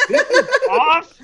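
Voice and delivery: high, loud, laughing excitement; retold stoked reaction. Racer retelling the cash bet news, stoked and laughing. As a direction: relive the thrill, pitch shooting up. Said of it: "I was so stoked when".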